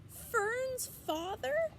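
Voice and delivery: very high, slow, wailing question; tearful slow disbelief, very high; sobbing. Acted. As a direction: stretch it out like a crying piglet. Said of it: "sobbed Wilbur".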